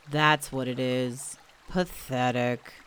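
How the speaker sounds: put-on low, slow, mopey old donkey; gloomy Eeyore. A narrator's reading. Eeyore looking at himself in the stream, gloomy and low. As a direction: sigh the word out like a sad donkey. Eeyore moping at his reflection.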